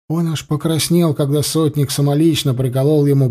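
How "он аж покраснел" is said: plain reading voice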